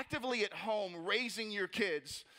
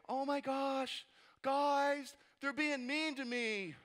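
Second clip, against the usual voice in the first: high, drawn-out mock whine; whiny self-pity; 5 semitones higher, much slower than usual. Nehemiah as he would never address the builders, whining. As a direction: play the wounded baby, hammed-up. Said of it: "first what he doesn't say".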